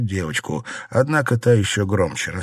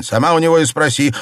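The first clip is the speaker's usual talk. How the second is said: louder, higher retort; irritated shout; 8 semitones higher, 6 dB louder, faster than usual. A narrator's reading. Husband tells wife to ask him, shouting. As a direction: throw it back crossly. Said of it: "крикнул Дзялянь".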